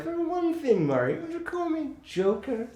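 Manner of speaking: high-pitched imitation of a film villain; Joker. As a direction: put on a high, theatrical movie-line voice. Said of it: "Such great just delivery".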